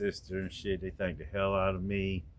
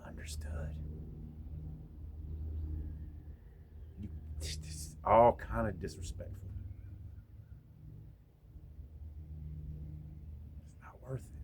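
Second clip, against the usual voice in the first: hushed whisper; soft whispered regret; 8 dB quieter than usual. Real, not acted. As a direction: breathe it almost silently. Whispered reflection on the fight.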